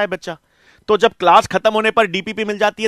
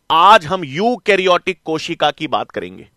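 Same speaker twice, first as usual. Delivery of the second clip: usual speaking voice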